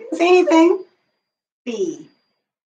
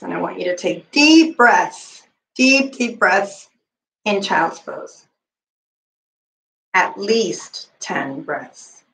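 calm yoga cue; 4 semitones lower, slower than usual. Acted. Relaxation cue for deep breaths.

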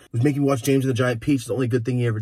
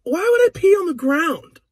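put-on high, loud, slow kid whine; fake-innocent kid; 8 dB louder than usual. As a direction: whine it like a wronged little boy. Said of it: "I went".

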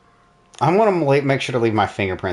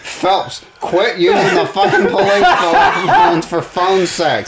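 high, loud, slow mock scolding; 8 semitones higher, 5 dB louder, slower than usual. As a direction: berate him like a fed-up boss, laughing.

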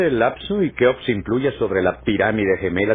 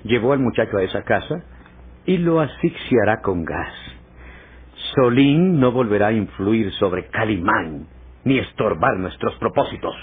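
ordinary delivery

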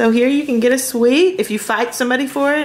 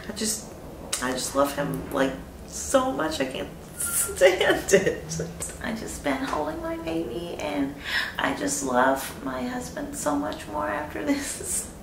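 holding back tears; 2 semitones higher, 8 dB quieter than usual. Real, not acted. New mother near tears about her baby, softly choked up. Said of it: "I feel like I'm gonna cry".

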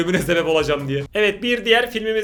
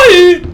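startled shriek; 11 semitones higher, 15 dB louder than usual. Real, not acted. Jump scare.

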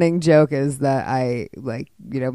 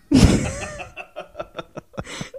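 long, high, mostly breathy laugh; real laughter; 12 semitones higher than usual. Real, not acted. Playwright amused by her own fruit cup line, laughing. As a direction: crack up at your own joke.

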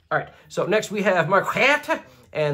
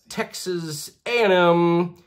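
ordinary delivery

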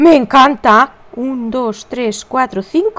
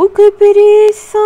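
much higher, louder, whiny sigh of laziness; groaning reluctance; 9 semitones higher, 5 dB louder than usual. Retold or imitated. Herself groaning at the empty water jug, whiny and much higher. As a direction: ham up not wanting to carry the water.

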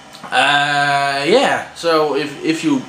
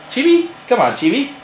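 calling a pet; 10 semitones higher, faster than usual. Real, not acted. Calling his pet to come.

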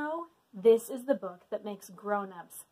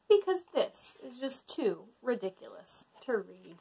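ordinary delivery, nothing marked

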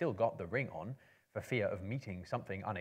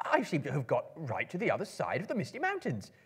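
higher, anxious little hobbit voice; high, louder worried Bilbo; fretful hobbit; 7 semitones higher, 4 dB louder than usual. Acted. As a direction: fret aloud like a lost, worried hobbit. Bilbo frets aloud, lost.

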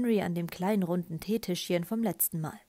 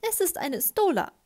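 high, louder voice of Misses Honeycutt; indignant correction; 9 semitones higher, 5 dB louder than usual. A narrator's reading. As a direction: correct him pointedly, pitched up. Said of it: "verbesserte ihn Misses Honeycutt". (mit hoher Stimme korrigierend)

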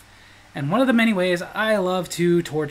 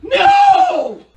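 very high loud shout; shrill loud no; 10 dB louder than usual. Retold or imitated. Wife refusing the sandwich, very high and loud.

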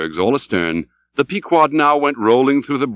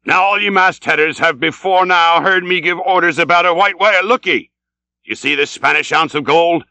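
raised, higher oratory to the crew; rally the men like a commanding captain; Ahab's forceful harangue; rousing sea captain; 6 semitones higher, 3 dB louder than usual. Acted. Ahab addresses the crew.

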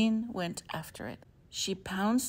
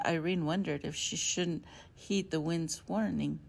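ordinary delivery, nothing marked

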